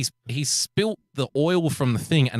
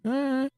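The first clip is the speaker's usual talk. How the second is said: soft, high wordless calls; imitated muffled noise; 12 semitones higher, 3 dB quieter than usual. Storyteller imitating what came through the headphones, muffled noise. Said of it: "and I hear this".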